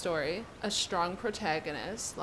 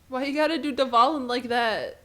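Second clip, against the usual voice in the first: loud protest; 4 semitones higher, 8 dB louder than usual. Real, not acted. Streamer protests the story twist.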